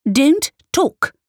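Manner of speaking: put-on high, loud snap at the child; sharp command. A narrator's reading. Mother silencing her daughter with a sharp command. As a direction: cut her off hard.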